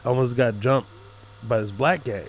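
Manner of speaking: ordinary talk, nothing marked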